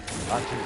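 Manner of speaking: sneezing. Real, not acted. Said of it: "I'm sorry about that".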